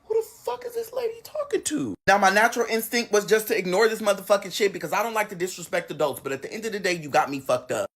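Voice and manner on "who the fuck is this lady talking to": very high, strained squeak; comic high-pitched bafflement; incredulous reaction. Retold or imitated. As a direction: squeak it softly in disbelief. Teller's stunned reaction to insult.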